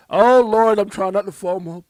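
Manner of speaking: high, loud cry of excitement; loud giddy yelp over the news; excited outburst. Real, not acted. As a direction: burst out, barely holding it in. Fighting FOMO over the XRP news.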